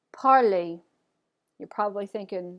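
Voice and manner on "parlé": "parler" as "par-lay", final R silent; French pronunciation. Shown as a demonstration. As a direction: model "parler" with its ending rhyming with play. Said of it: "listen to the pronunciation for this".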